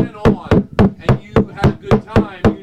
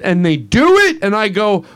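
ordinary delivery

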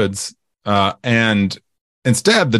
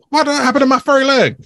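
high mock voice; faster than usual. Voicing character, high fast.

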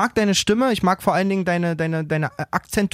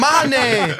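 rage moment; 6 semitones higher than usual. Real, not acted. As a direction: vent in frustrated laughter. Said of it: "kurzer Rage-Moment hier".

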